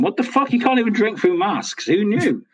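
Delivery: high, baffled outburst; what the hell, exasperated; exasperated surprise. Real, not acted. Struggling to drink through a mask.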